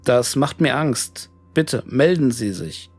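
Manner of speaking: ordinary delivery, nothing marked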